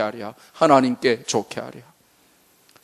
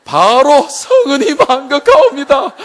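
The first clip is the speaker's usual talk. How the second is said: loud, high courtly exclamation; period drama courtier; 11 semitones higher, 6 dB louder than usual. Acted. Congregation answering like courtiers, hammed-up courtly voice. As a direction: gush like a subject in a historical drama. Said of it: "저분들이 사극을 많이 보셨어요".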